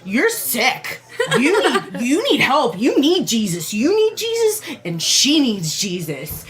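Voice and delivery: high-pitched, indignant mock yell; hammed-up outraged street preacher, pitch leaping up; mock yelling zealot. Friend acts out preacher scolding her on the sidewalk, mock yelling. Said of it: "he, like, started yelling".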